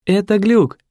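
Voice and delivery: high-pitched, insistent secretary; anxious secretary. A narrator's reading. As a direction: pipe it up like a flustered assistant. Secretary repeats his explanation.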